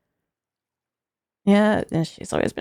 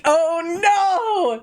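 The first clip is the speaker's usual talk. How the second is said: ordinary talk, nothing marked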